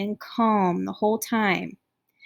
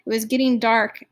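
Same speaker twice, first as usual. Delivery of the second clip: plain reading voice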